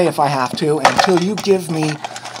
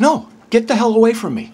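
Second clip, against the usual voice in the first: loud, higher-pitched order to back off; angry demand; 5 semitones higher, 3 dB louder than usual. Real, not acted. Man orders a bystander away from him, angry demand. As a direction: raise your voice and warn him away.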